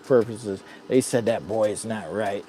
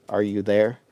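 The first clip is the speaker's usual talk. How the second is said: ordinary talk, nothing marked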